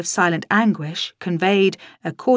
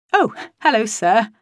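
cheerfully; 4 semitones higher, 3 dB louder than usual. Acted. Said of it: "I said cheerfully".

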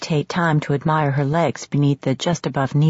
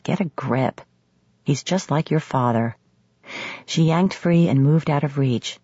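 ordinary talk

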